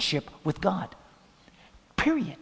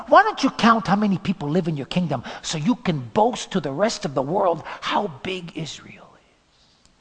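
tempting devil; 4 semitones higher, 5 dB louder than usual. Acted. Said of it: "the devil tempted him".

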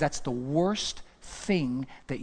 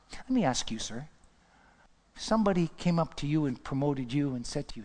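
ordinary delivery

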